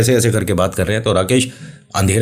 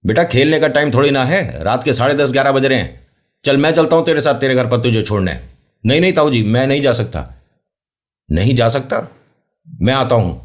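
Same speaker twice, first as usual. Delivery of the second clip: calling across field; 6 semitones higher than usual. Acted. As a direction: raise the voice over distance. Uncle and boy calling.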